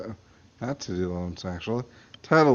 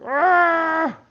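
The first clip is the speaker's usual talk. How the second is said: short death scream; 6 dB louder than usual. Acted. Death cry.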